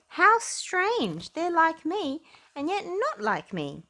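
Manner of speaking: puzzled and amazed at the ducklings; wondering platypus. Acted. Percy seeing the ducklings, high amazed wonder.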